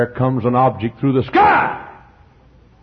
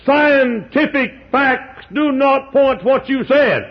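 higher, slow mock voice of a doubter; objector voiced; 13 semitones higher, slower than usual. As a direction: mimic the sceptic's protest. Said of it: "But you say".

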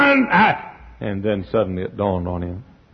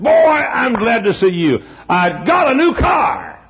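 much higher, slower, loud proud exclaim; high-pitched excited shout; excited friend; 11 semitones higher, 4 dB louder, slower than usual. Retold or imitated. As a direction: crow it like a proud man with a new toy. Friend shows off his car.

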